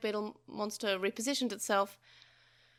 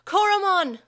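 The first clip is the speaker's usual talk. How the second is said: very loud, high anguished scream of a name; boy screaming for his Digimon; 10 semitones higher, 10 dB louder than usual. A narrator's reading. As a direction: shriek his partner's name. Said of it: "Taichi screamed".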